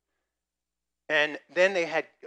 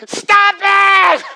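very loud, very high yell; wife in labour yelling at husband, furious shout; shouted stop it; 22 dB louder than usual. Retold or imitated. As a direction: yell at him to quit. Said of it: "Sue looks at me and goes".

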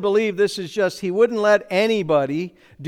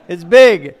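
"big" shouted out, louder, falling hard; much louder; 12 dB louder than usual. Acted. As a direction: punch "big" with a big burst of volume. Preacher repeats the point, louder.

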